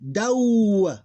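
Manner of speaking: dow high, loud and drawn out; diphthong after D. Shown as a demonstration. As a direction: hold the a-to-u glide in dow. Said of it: "we put the letter D in here".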